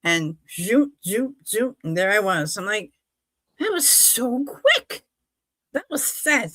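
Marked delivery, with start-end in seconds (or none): 3.6-6.5 amazed. Retold or imitated. Amazed at arriving so quickly.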